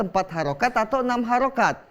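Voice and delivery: usual speaking voice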